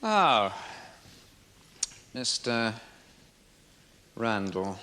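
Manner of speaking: slow, with long pauses between words; deliberate, weighty opening. Acted. Barrister opening cross-examination of the witness, deliberate and drawn out. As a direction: draw out each word, leaving silences.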